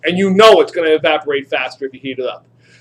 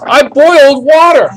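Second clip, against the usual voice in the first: slow, louder proud declaration; booming mock triumph, slowed; triumphant announcement; 7 semitones higher, 7 dB louder, slower than usual. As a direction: proclaim it like a great discovery.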